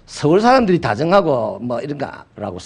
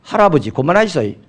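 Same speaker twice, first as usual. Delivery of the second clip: curt Gyeongsang dialect plea to grandpa; 무뚝뚝한 경상도 사투리; blunt Gyeongsang child. Retold or imitated. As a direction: tell grandpa to knock it off in flat Gyeongsang dialect. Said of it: "경상도 아이에게".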